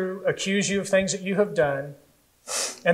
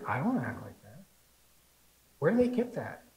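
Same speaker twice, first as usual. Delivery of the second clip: quiet, baffled objection; soft mock bewilderment; puzzled viewer; 2 semitones lower, 8 dB quieter than usual. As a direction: voice a bemused TV watcher under the breath. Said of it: "you look at it and you go".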